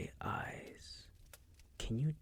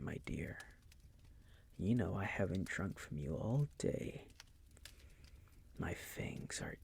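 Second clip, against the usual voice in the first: ordinary talk, nothing marked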